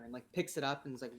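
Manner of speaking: usual speaking voice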